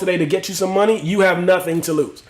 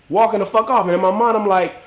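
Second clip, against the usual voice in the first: usual speaking voice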